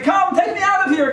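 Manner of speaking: very high shouted calls; comic scream. Retold or imitated. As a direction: shout for the Rebbe in panic, hammed up. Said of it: "Screaming at the Lubavitcher Rebbe".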